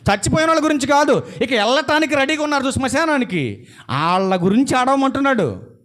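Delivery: high-pitched, driving sermon voice; forceful preaching. Real, not acted. Preacher asking congregation why they weep for the dead, forceful preaching. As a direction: press the question urgently on the listeners.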